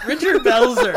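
high, loud eureka; excited recognition. Real, not acted. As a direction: say it like you just remembered.

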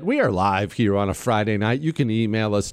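ordinary talk, nothing marked

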